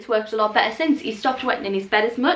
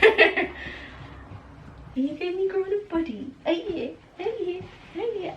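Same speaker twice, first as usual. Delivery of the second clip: high, softer cooing; 9 semitones higher, 6 dB quieter than usual. Real, not acted. Owner cooing at her puppy, high and soft. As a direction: coo it softly up high.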